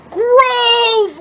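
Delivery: high, loud cry of disgust; loud, high mock disgust. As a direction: recoil at the rotten sandwiches. Disgust at sandwiches.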